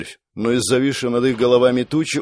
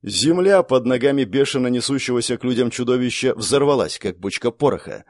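plain reading voice

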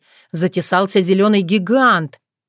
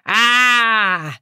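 brave yell; 4 semitones higher than usual. A narrator's reading. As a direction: yell while sliding off the roof. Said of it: "огласить окрестности бравым воплем".

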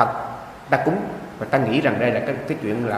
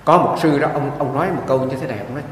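ordinary talk

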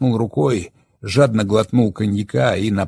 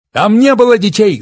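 furious yell, much higher and louder; 13 semitones higher, 11 dB louder than usual. Acted. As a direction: bellow it out in outrage. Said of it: "заорал Олег".

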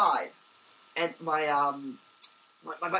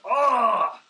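loud frustrated shout, louder; retold angry shout; 5 semitones higher, 8 dB louder than usual. Soaked cyclist yelling at an empty street, loud frustrated shout. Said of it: "I literally shouted at this empty street".

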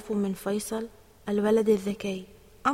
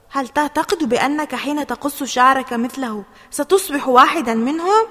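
loud, higher, incredulous challenge; challenging question; 3 semitones higher, 8 dB louder than usual. A narrator's reading. Mother doubting Ali will join the clique, challenging. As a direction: push the question up loudly at the end.

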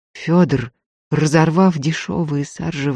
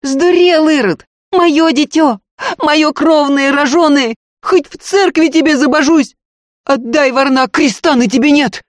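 very high, loud desperate howl; wailing; 13 semitones higher, 5 dB louder than usual. A narrator's reading. Maid tugging the baby from the robber, wailing. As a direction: scream for your child at the top of your voice. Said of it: "вопила Дунька".

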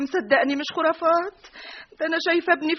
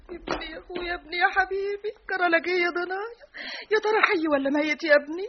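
mournful lament; 6 semitones higher, 5 dB quieter than usual. Acted. Mother lamenting lost son.

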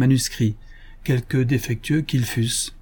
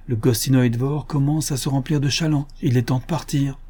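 ordinary delivery, nothing marked

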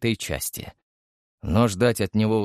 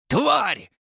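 high shrieked insult; lieutenant in rage hurling a mug; furious curse. A narrator's reading. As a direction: scream it as he throws the mug.

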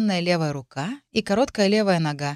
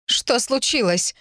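higher, drawn-out startled question; alarmed cry; 6 semitones higher, slower than usual. A narrator's reading. Stepmother rushes to the fallen girl, crying out. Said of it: "закричала мачеха".

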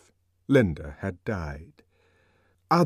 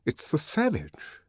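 scared hush; 7 semitones higher than usual. Acted. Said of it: "He felt scared".

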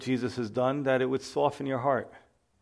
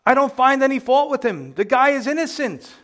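much higher, louder plea; crying out; 10 semitones higher, 5 dB louder than usual. Acted. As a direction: cry it out as Pilate, pleading his innocence. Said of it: "Pilate cries out".

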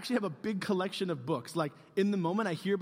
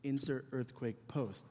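slow dictation; 4 semitones lower, 8 dB quieter, much slower than usual. Retold or imitated. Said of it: "What if I put".

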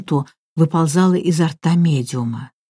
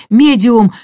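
louder, higher urgent cry; alarmed shout; 4 semitones higher, 7 dB louder than usual. A narrator's reading. Raoul reminding her of the endangered medium, alarmed shout. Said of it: "закричал Рауль в тревоге".

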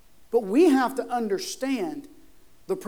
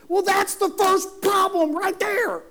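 high, shouted sermon delivery; full-force preaching; 10 semitones higher, 10 dB louder than usual. Real, not acted. Preacher driving home whose power it is, full-force preaching. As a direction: thunder it out to the congregation, stretching key words.

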